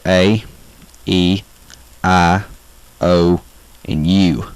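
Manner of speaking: drawn-out Southern vowels, one at a time; Southern accent vowels. Shown as a demonstration. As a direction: recite the vowels with a Southern drawl. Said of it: "how to talk in a typical Southern accent".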